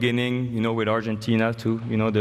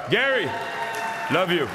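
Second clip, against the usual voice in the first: much higher, called out across the room; calling out; 6 semitones higher than usual. Real, not acted. Calling out to Gary Payton in the crowd, much higher. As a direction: hail him warmly from the stage.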